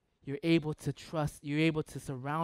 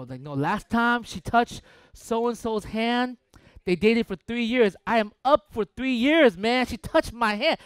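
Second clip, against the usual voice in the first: loud, high, slow protest; excited insistence; 7 semitones higher, 8 dB louder, slower than usual. Retold or imitated. Friend insisting she loves him, loudly and excitedly. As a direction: insist it's true, getting louder. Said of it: "it's like".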